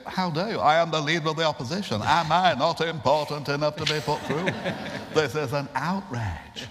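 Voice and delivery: a hammed-up leader of the opposition fuming; William Hague outrage. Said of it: "with Hague saying".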